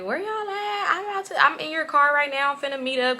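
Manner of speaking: high-pitched mock phone demand; imitated girlfriend calling. Girlfriend calling to find out where they are, demanding.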